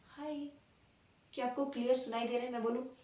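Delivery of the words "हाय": a very soft, faint "हाय". Shown as a demonstration. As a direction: barely voice the "हाय". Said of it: "अगर मैं बोल रही हूँ आपसे".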